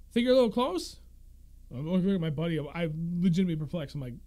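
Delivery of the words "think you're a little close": higher, louder pointed question; annoyed homeowner. Retold or imitated. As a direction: needle the fisherman. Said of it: "He's like".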